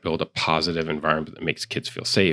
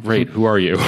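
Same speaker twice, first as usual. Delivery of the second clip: laughing; 5 semitones higher, 4 dB louder than usual. Retold or imitated. Retold puzzled reply to email.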